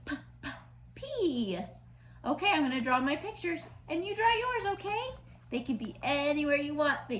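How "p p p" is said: "P" said as a soft popped p; P sound. Shown as a demonstration. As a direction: pop the p sound quietly.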